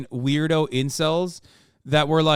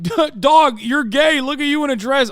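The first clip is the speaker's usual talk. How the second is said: high, louder dudebro jeer; mock bro taunt; 7 semitones higher, 6 dB louder than usual. Streamer imitating Tate fanboys taunting him, high mock jeer. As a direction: jeer like a smug fanboy thinking he scored. Mocking fanboys posting dress photos.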